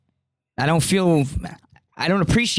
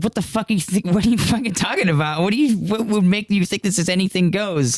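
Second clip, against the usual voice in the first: higher-pitched, stumbling retort; indignant disbelief; 6 semitones higher, faster than usual. Retold or imitated. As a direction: act out a baffled, indignant comeback. Retold indignant reply to the advance.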